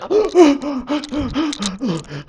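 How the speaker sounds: high, repeated gasps for air; exaggerated gasping. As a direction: surface gasping after a long dive. Heron surfaces after long dive.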